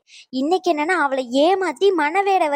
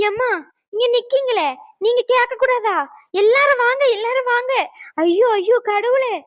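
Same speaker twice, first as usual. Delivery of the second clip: dramatic wailing; 6 semitones higher than usual. Acted. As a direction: wail Aiyo and call the guests over, pitched high. Aunt wails, calls everyone over.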